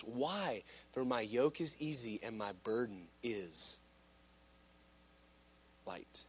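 low, hushed scripture climax; hushed reverence. Real, not acted. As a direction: drop low and soft, pause before the last word.

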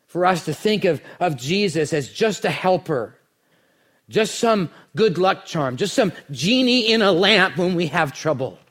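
usual speaking voice

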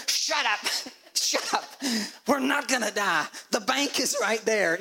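acted dad losing patience; exasperated parent. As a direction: set panicking kids straight, fed up. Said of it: "tell your kids to shut up".

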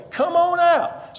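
inviting call. Acted. Jesus invites Peter.

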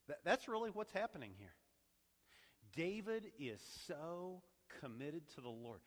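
ordinary talk, nothing marked

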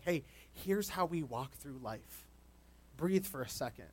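slow, soft, gentle guidance; walk them through it slowly and softly; gentle instruction. Retold or imitated. Older mentor gently guiding a younger person, slow and soft. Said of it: "loving, gentle instruction".

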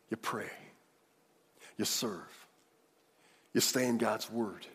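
ordinary delivery

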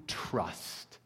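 whispered trust; whispered word. Real, not acted.

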